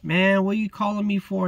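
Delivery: high-pitched, defensive retort; mock indignation. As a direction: imitate someone bristling at being called over. Acted confrontation with guard.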